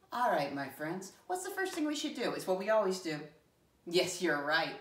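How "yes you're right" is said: normal talking voice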